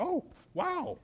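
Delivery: high, startled little gasp of wow; surprised. Retold or imitated. Preacher amazed that his prayer for someone healed them, jumping up high in surprise. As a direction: let the wow jump up in surprise. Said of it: "why was I surprised".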